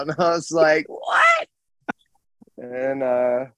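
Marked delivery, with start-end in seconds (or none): 0.9-1.5 very high squeaked disbelief; mock outraged squeal of surprise; retold shock. Retold shock at mom's answer.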